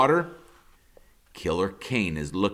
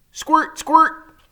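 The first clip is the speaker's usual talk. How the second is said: imitated squirt sound; 9 dB louder than usual. A narrator's reading.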